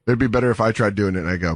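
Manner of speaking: ordinary talk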